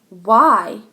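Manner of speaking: loud challenging question; defiant why. Acted. Max challenges the teacher, loud. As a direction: refuse to back down.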